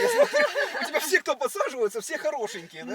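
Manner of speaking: teasing through laughter; laughing. Real, not acted. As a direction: laughs loudly while teasing. Teasing her about handsome men. (со смехом, подкалывая)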